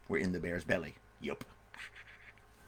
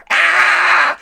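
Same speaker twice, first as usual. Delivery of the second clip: high, loud shriek; shrill storybook scream of fright; boy's scream; 14 dB louder than usual. Acted. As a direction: scream out like a terrified boy. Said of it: "the boy screamed".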